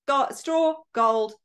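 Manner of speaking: ordinary delivery, nothing marked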